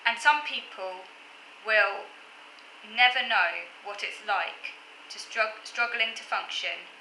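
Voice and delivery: slow, measured poetry reading; poem recitation. Acted. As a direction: read each line deliberately, pausing between them. Reads own poem aloud.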